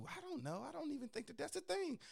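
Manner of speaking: high, soft coy denial; mock innocent girl. Pretty home girl denying privilege, high and coy. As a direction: play dumb sweetly. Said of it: "I was just like".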